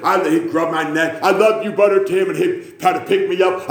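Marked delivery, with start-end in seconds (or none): 1.2-2.3 lightly imitated eager greeting, a bit higher; James. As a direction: imitate a simple, loving churchgoer's hello. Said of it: "and he'd say".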